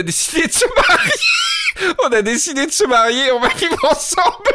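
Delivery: punchline shouted through laughter; cracking up on the punchline; laughing shout. Real, not acted.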